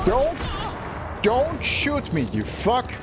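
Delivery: raging gamer. Real, not acted. Pleading then cursing at enemy.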